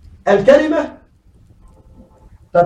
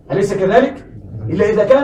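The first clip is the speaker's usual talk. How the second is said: usual speaking voice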